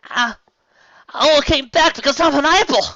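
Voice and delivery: very high, loud tearful plea; desperate plea. Acted. Applejack pleading with family, high loud plea. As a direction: beg them to believe her.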